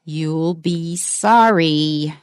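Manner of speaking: slow, menacing stress on "One day"; drawn-out threat, both "one" and "day" hit hard; threatening. Shown as a demonstration. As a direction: warn them they will regret it. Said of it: "because it's a threat".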